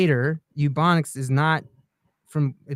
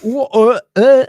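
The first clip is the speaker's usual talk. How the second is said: mock English accent; 10 semitones higher, 9 dB louder, slower than usual. Joins mocking British "water.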